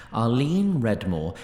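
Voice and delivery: usual speaking voice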